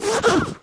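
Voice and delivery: a short high burst of held-in laughter; can't keep the giggle in; stifled laugh. Real, not acted. Big brother failing not to laugh at his sister, stifled laugh. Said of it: "I'm trying not to laugh".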